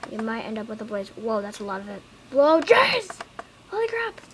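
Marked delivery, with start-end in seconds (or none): 2.3-4.2 much higher, loud surprise; startled shout. Real, not acted. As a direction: burst out in disbelief. Shocked at huge spawn.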